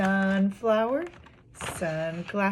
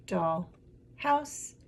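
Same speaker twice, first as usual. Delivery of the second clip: syllable by syllable. Shown as a demonstration. Teacher repeating the two syllables heard, broken apart. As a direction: split "doll" and "house", lifting the second. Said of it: "I heard".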